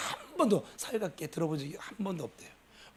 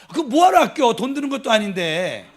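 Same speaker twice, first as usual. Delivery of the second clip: higher and louder playful scolding; loud teasing exclamation; 4 semitones higher, 8 dB louder than usual. Real, not acted. Pastor teasing husbands who won't say I love you, loud. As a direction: chide them loudly in fun.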